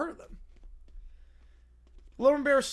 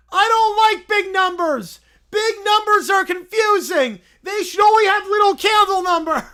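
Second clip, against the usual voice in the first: whining bear; 7 semitones higher, 10 dB louder than usual. Acted. Little Brown Bear whining about big numbers, high and loud. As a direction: whine like a cranky toddler. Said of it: "He begins whining more".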